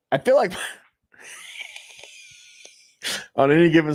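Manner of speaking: laughing outburst. Real, not acted. Laughing at wife joke.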